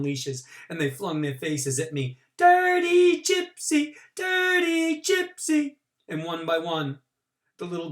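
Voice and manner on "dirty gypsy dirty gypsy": very high, slow sing-song taunt; children jeering at the boy, high and slow. A narrator's reading. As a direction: chant it like a jeering playground crowd.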